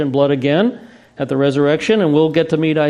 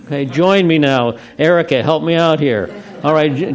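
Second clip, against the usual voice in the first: usual speaking voice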